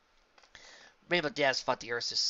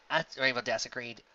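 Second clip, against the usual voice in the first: ordinary delivery, nothing marked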